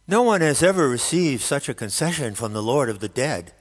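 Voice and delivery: grave, weighty royal decree; solemn tone. A narrator's reading. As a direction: proclaim it solemnly like a lord. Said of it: "Hades announced in a solemn tone".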